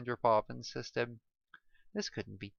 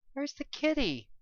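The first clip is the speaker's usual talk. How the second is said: put-on dreamy childlike question; 13 semitones higher than usual. Drugged patient asks dreamily.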